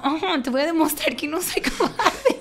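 high mock-defiant line through a laugh; laughing defiance. Retelling to her husband her prideful resolve, laughing defiance. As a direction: challenge him while laughing.

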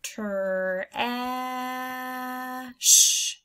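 put-on "Ter, ah, sh" sounded out for children; segmented sounds. Shown as a demonstration. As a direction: split the word into three sounds. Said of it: "It would sound like this".

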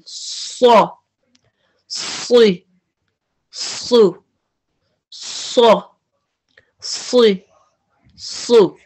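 सौ, सी, सु each said heavy with the tongue shaped like a spoon; heavy vowel drill. Shown as a demonstration. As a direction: run through सौ सी सु, thick and full. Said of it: "वैसे आपकी जुबान ऊपर जाएगी".

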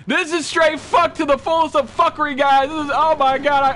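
high, loud alarmed rant; freaking out to the viewers; panicked yell. Real, not acted. Panic in cave.

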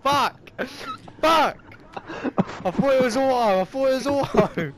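high, loud angry yells; yelling after a fall; frustrated shout. Real, not acted. Falling in game, shouting.

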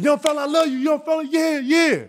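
high, loud, amused praise; imitating Jordan. Jordan praising the young fella, loud and high. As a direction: hype the kid up, high and loud.

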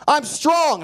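put-on high, forceful kid's cry; emphatic declaration. Boy declares who he is while hammering, emphatic cry. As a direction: proclaim it with a burst of energy.

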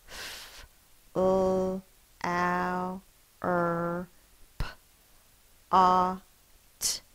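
"F, l, ow, er, p, a, t" each sound apart. Shown as a demonstration. Teacher sounding out flower pot, sound by sound. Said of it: "Sound it out".

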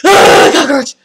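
loud, high panicked outburst; panicked yell. Retold or imitated. Her brain freaking out over the bug, panicked yell. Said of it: "My brain is like".

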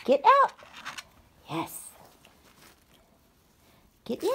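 ordinary talk, nothing marked

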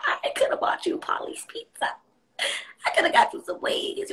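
hushed, wounded jab at the ex; whispered reproach. Retold or imitated. Scolding the ex who left her, in a hushed voice. Addressing the cheating ex.

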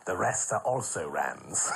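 low, softening theatrical grande dame; Danny La Rue. Retold or imitated. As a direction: purr it like an old stage star. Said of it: "he'd say things like".

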